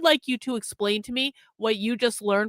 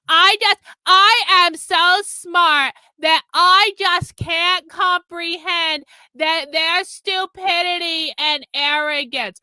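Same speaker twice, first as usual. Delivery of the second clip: high, loud, slow mocking voice; mocking arrogant chatter; 6 semitones higher, 6 dB louder, slower than usual. Streamer mocking an arrogant chatter, high slow sneer. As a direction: mimic a smug know-it-all, slow and high.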